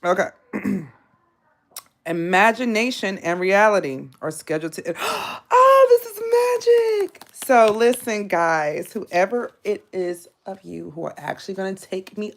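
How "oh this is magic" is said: much higher, louder gasping delight; oh, this is magic, breathless and thrilled; gasp of surprise. Real, not acted. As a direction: gasp as the card turns up. Gasps at the card drawn.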